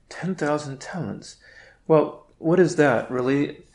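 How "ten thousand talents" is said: softer repeat of "Ten thousand talents"; quiet echo. Shown as a demonstration. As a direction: drop the voice and let "Ten thousand talents" sink in. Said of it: "speaks of ten thousand talents".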